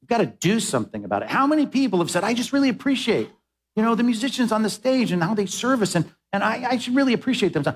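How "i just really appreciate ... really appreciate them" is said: mock appreciation. Mimicking what people say.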